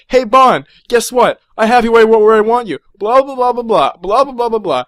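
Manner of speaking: loud, high mock villain prattle; mock a long-winded villain's boasting; gloating Bond villain. Mocking a gloating Bond villain's speech.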